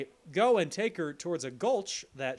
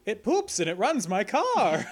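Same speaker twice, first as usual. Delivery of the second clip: mock slogan; 7 semitones higher, 6 dB louder than usual. Joke shirt slogan.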